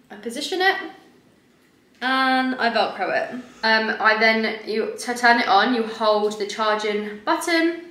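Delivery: rigid mask over face; speaking through a face mask. Real, not acted.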